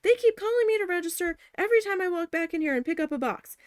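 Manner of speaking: high, loud exasperated outburst; losing it. Retold or imitated. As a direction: snap back at the boss, fed up. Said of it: "I lost it".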